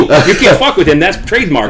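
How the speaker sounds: usual speaking voice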